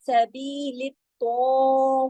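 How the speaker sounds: slow, stretched Quranic chant with the ta held heavy; drawn-out tajweed recitation. Shown as a demonstration. Teacher showing students how to recite, slow and drawn. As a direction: stretch each sound like careful recitation. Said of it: "सख्ती से जोड़ना है लित तौ को".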